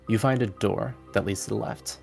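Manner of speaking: normal talking voice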